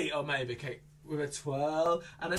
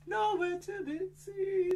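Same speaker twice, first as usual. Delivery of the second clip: mock wail; 12 semitones higher, slower than usual. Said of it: "repeated, higher crying tone".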